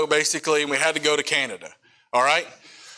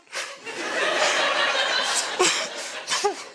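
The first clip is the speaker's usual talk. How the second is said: disbelieving laugh; 3 dB quieter than usual. Real, not acted.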